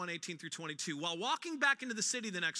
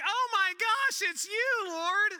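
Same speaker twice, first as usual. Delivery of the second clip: much higher and louder, thrilled recognition; amazed shout; 10 semitones higher, 7 dB louder, slower than usual. Retold or imitated. Thomas seeing the risen Jesus, amazed shout. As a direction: burst out as if seeing a friend alive.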